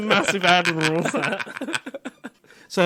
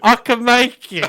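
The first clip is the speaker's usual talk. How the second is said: put-on drunken confidence; 6 semitones higher, 5 dB louder, slower than usual.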